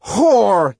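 high, loud enraged bellow; furious shout, high and loud. Acted. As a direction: spit the insult out in fury. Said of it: "he shouted".

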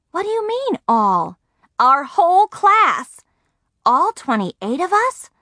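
high, drawn-out disbelief; astonished. A narrator's reading. Margaret hears the whole class is invited, astonished. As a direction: build to loud incredulity. Girl astonished by friend's news.

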